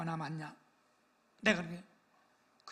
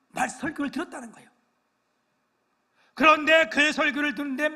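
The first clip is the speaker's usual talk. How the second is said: fervent preaching; 4 semitones higher, 10 dB louder than usual. Real, not acted. Pastor tells story with rising fervour.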